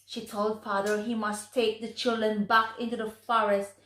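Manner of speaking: plain reading voice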